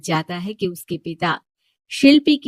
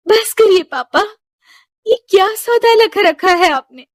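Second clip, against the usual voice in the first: much higher and louder outburst; furious daughter; 13 semitones higher, 6 dB louder than usual. Acted. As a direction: shout at him to stop. Daughter stops father's bargaining.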